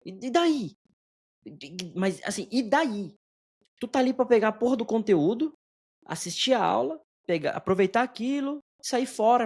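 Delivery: high-pitched indignant challenge; exasperated. Real, not acted. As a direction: argue back, pitch pushed well up. Exasperated rant at complaining students.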